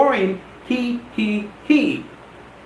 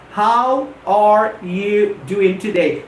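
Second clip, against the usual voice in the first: slow, deliberate separate words; slower than usual. Shown as a demonstration. Teacher demonstrating to listeners, word by word. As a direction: demonstrate each word on its own. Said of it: "to what I'm demonstrating here".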